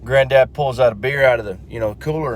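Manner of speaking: usual speaking voice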